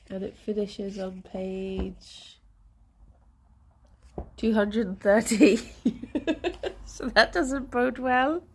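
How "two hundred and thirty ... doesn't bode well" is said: words broken by rueful laughter; laughing in dismay. Real, not acted. Streamer realising how far behind she is, laughing ruefully. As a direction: laugh through it in disbelief.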